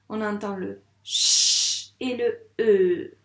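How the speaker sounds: ch et e étirés lentement, plus fort; détacher le ch et le e; sounds drawn out. Shown as a demonstration. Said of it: "Dis-moi les deux sons". (sons ch et e bien détachés et lents)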